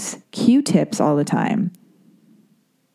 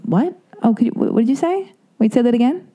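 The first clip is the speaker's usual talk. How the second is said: higher, puzzled questions that rise at the end; straining to catch what was said; confused asking again; 6 semitones higher than usual. Retold or imitated. Retelling herself asking people to repeat themselves, higher and puzzled.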